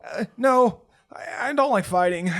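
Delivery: put-on high, loud shy boy voice; timid kid. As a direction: shrink from violence.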